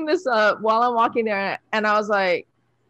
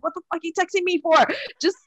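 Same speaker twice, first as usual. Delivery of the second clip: impatient mentor; 10 semitones higher, much faster than usual. Retold or imitated. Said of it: "He's like".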